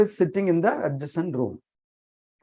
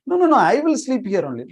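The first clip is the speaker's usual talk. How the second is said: quick, raised, louder protest; insistent refusal; 6 semitones higher, 6 dB louder, faster than usual. Acted. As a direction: brush off the worry in a hurried, higher voice. Acted hostess refusing the guest's offer.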